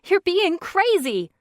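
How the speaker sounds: high, loud panicked shout. A narrator's reading. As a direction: yell at him to stop. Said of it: "she shouted".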